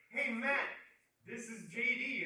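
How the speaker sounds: loud, high greeting; imitated shouted introduction; calling out. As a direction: hail someone across the room. Host calls out to headliner.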